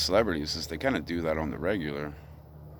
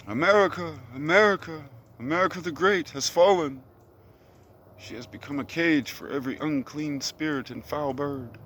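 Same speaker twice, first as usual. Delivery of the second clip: much higher, declaiming like a street preacher; booming prophecy; 8 semitones higher than usual. Acted. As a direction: proclaim doom from a hilltop. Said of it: "booming my voice off the top of this hill".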